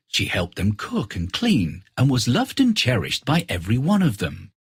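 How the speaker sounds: ordinary delivery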